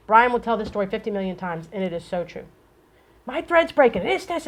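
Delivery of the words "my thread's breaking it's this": high-pitched imitation of an upset customer; heated caller. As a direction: mimic a worked-up caller, pitched up. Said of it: "you're in a heated moment when you call".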